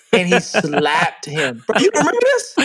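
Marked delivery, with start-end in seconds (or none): 1.7-2.5 thrilled, checking his friend remembers; excited. Real, not acted. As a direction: voice leaps high, eager. Excited recall of comedy sketch.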